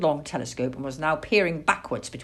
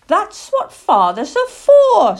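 high, loud, slow little owl voice; young owl teasing his dad; cheeky owlet; 13 semitones higher, 13 dB louder than usual. Acted. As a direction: pipe it out cheekily, drawn out. Plop cheekily answers his father.

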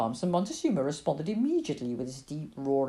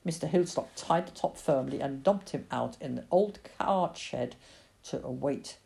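usual speaking voice

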